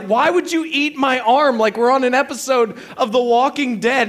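high, loud retold protest; incredulous wife. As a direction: imitate an appalled wife arguing. Acted quarrel, wife's side.

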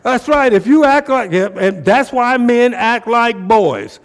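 high, loud preacher challenging the room; fired-up preaching. Acted. Preacher challenging the men in church to man up, fired-up preaching. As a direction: push it out hard, stretching key phrases.

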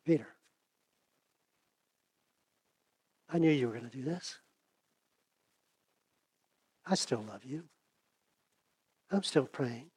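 very soft, gentle reassurance; tender forgiveness. Acted. Jesus to Peter after his denial, tender and forgiving. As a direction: drop right down and speak lovingly, with pauses.

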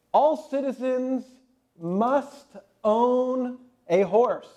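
slow, high proclamation; drawn-out royal decree; town crier. Retold or imitated. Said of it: "the town crier".

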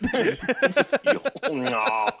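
high, loud laughing voice. Pretend. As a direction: squeal it through laughter. Laughing high mock reply.

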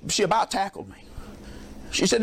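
ordinary talk, nothing marked

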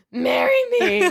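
high, slow, choked-up plea; tearful proposal. Retold or imitated. Ed finally proposing to Norma, voice breaking. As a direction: proposal through tears. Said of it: "he looks at her and he says".

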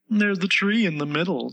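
high-pitched, mannered flower voice; prim Rose voice. A narrator's reading. The Rose explains manners to Alice, prim and high. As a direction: lecture primly, pitched high. Rose's voice in the story.

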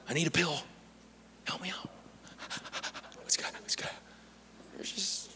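soft, gasping, hushed panic; mock worried bird. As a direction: pretend the bird is fretting. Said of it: "Are they like".

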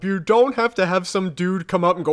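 ordinary delivery

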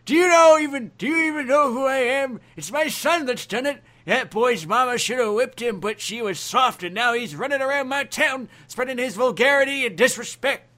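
high, loud cranky rant; ranting old man. Acted. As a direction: scold like a bitter old man.

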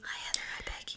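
breathy whisper to friends; stage whisper. Acted. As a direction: whisper it like a secret greeting. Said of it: "a whisper shout out".

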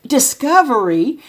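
high, slow and loud; mother teasing son, high mock wonder; laying it on thick. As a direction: stretch out "Discovery." with mock wonder. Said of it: "lay it on thick".

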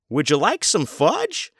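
buffet guy offering a child fudge, hammed-up; mock server voice. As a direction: draw it out sweetly to a kid. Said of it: "Or even".

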